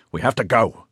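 shouted. A narrator's reading. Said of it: "he shouted at her".

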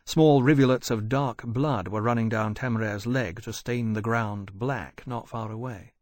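ordinary delivery

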